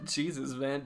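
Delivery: laughing through nerves; a shaky, uneasy laugh; nervous laugh. Real, not acted. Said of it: "I'm laughing 'cause I'm nervous".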